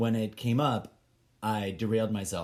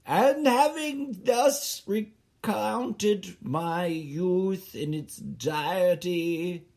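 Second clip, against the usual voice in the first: higher, slow, pompous recital; grand letter voice; 6 semitones higher, 3 dB louder, slower than usual. Pretend. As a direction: declaim the letter like a grand epistle. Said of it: "I was writing a letter".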